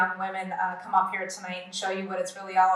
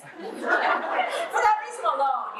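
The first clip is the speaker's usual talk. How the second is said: laughing; 8 semitones higher, much slower than usual. Real, not acted. Joking about chicken patties.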